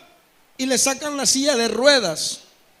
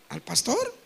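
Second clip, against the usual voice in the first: ordinary talk, nothing marked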